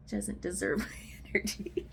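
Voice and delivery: whispered through a laugh. Real, not acted. Vlogger laughing at her own avoidant excuse, whispered. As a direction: giggle it out under the breath, half mocking.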